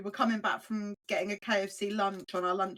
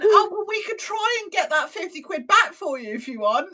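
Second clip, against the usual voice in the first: higher and louder, crying with laughter; laughing through the quote; 8 semitones higher, 5 dB louder than usual. Real, not acted. Retelling the scam call to friends, laughing through it. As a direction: barely get the words out for laughing.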